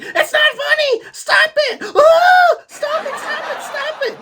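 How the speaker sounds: loud, very high hammed-up shrieks; mock panicked pleading. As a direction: squeal in overacted desperation. Boss begs grandfather to stop.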